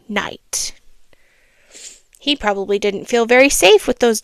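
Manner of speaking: storybook reading voice; a child reading a fable aloud; story narration. A narrator's reading. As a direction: read it like a bedtime story.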